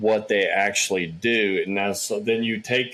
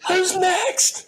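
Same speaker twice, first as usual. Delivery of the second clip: mock angry yell. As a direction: bark it out like a confused old man on stage.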